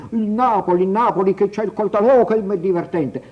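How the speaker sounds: high, loud mock of an amused northerner; mocking northern commentator. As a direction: sneer it like a Lombard pundit. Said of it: "lei piacerà ai commentatori lombardi".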